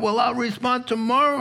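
procrastinator. Acted. Voicing a procrastinator.

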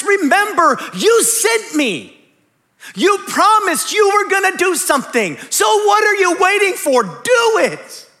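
loud, high, demanding prayer; bold plea to God. Acted. Moses crying out to God, loud and demanding. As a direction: shout it up at heaven like Moses.

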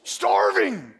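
loud, blurted caveman-like demand; hammed-up hungry demand. Voicing famished Esau demanding food.

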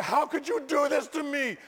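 acted-out wail of complaint; indignant protest. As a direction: protest like a betrayed husband. Retold silent protest at his wife.

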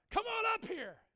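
loud, high summons; calling up front. Retold or imitated. Preacher calling a reluctant person up, high loud shout.

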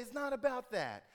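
high, loud, fed-up cry; exasperated cry. Retold or imitated. You to a friend who went off topic, loud and fed up. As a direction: cut off a tangent with frustration. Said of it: "and you say".

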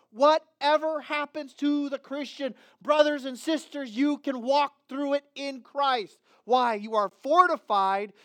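loud, high, fervent preaching; rising pulpit fervour; full-force preaching. Acted. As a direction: preach it out to the congregation, building.

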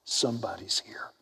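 whispered word going round; secretive, soft announcement; hushed rumour. Acted. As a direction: pass it on in a whisper. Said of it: "The word goes out".

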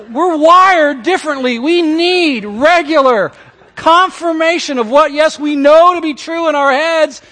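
loud, high, urgent sermon voice; preaching at full force. Acted. Pastor urging husbands, preaching loud and high. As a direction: drive it home to the congregation.

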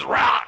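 loud playful heave sound; hammed-up regurgitating bark; mock retching roar. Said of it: "goes to the babies and goes".

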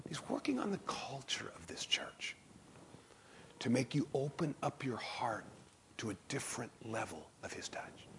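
soft whispered assurance; hushed whisper. Real, not acted. Preacher whispering during the altar call. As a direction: whisper it over the room.

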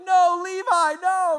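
high, loud despairing plea; anguished cry. Retold or imitated. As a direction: cry out in dismay. Car smoking, pleading with it.

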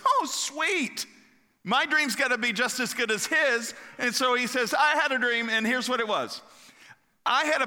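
high, bright-eyed excitement, voice jumping up; the baker can't wait for his turn; eager baker. Acted. Baker eager to hear his dream.

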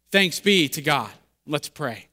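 ordinary talk, nothing marked

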